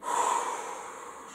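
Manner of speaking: soft voiceless whoosh of breath; long exhale. Shown as a demonstration. Coach releases pressure with pupils, soft long exhale. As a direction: blow all the air out gently.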